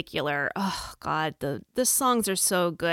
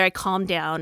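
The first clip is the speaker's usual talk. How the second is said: normal talking voice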